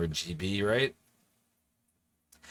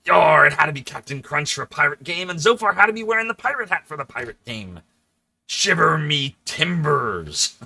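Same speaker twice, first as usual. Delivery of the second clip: hearty hammed-up pirate, louder and higher; 5 semitones higher, 7 dB louder than usual. Streamer in a pirate hat, hamming up a pirate voice. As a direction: belt it out like a stage pirate.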